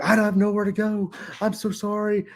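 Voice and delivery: high mocking voice of the pleading guest, mildly whiny. Host mimics the difficult guest's apology, high and mocking. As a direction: pitch it up and play her apology as pathetic. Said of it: "I mimicked them".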